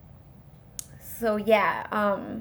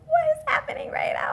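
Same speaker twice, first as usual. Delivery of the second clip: high, airy whisper of disbelief; acted breathless shock; faster than usual. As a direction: whisper it as if stunned, acting it out. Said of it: "I was just shocked".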